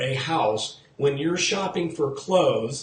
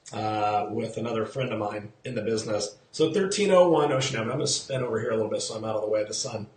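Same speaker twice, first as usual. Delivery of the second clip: ordinary talk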